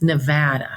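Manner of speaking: "Nevada" stressed on short middle A; short A as in ant. Shown as a demonstration. Senator teaches memory trick, "Nevada" with short A. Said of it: "Remember the, the A".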